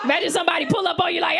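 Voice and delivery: ordinary talk, nothing marked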